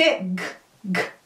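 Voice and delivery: bare G burst, no vowel; pure G sound. Shown as a demonstration. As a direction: voice a G with no vowel after. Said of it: "é esse o som do G". (G puro, sem vogal)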